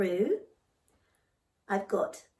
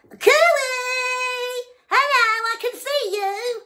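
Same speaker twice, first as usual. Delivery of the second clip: very high, loud sing-song call; frog shouting; 12 dB louder, slower than usual. Acted. Noisy frog shouting at the passing kangaroo. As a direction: yell it out cheekily from the pond. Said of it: "our frog shouted".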